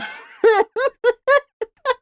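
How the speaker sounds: loud squealing laugh; high shrieking laugh. Real, not acted. Cracking up at the oink story, high shriek.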